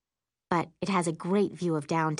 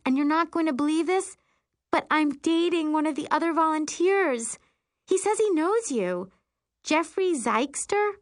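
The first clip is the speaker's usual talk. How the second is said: put-on high-pitched, breathless-with-news girlish gush; 10 semitones higher, 3 dB louder than usual. A narrator's reading. Friend telling about her new boyfriend, gushing. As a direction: gush on in a much higher voice, stretching the praise. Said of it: "she gushes".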